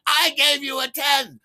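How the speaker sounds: slow, high, loud scream; screaming protest. Retold or imitated. Buyer holding up the dollar bill, screaming protest. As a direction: scream each word out long. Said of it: "I just start screaming it".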